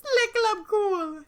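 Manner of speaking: slow, drawn-out mock French on "Le Club Cool"; French accent. Cohost voicing the Countess naming the club, mock French accent. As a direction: lay the French on thick, savoring each word. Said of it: "makes me want to do a French accent".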